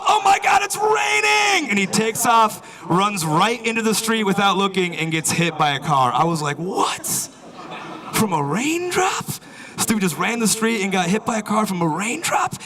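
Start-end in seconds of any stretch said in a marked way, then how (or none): 0.1-1.7 very high, loud panicked scream; mock freak-out. Businessman hit by second raindrop, high drawn-out hysterical shout. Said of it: "people freak out in the city from rain". 6.6-9.2 high, slow disbelieving question; incredulous. Retold or imitated. Witness to a raindrop-caused accident, high incredulous question.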